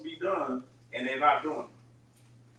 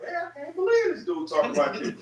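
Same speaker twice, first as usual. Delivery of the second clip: higher-pitched mock disbelief; blown away; 7 semitones higher than usual. Bystander hearing a brother talk behind the leader's back, blown away. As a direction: act out a stunned bystander. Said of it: "you might be right there and be blown away".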